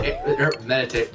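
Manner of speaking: ordinary delivery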